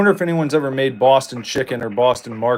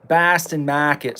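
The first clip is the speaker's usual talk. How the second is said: slow Boston drawl, r-less Bahstan Mahket; Boston accent; slower than usual. Pretend. Gamer joking about the restaurant in a Boston accent.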